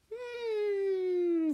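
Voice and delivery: high thinking hum; a doubtful fish humming. Acted. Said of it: "said Fish".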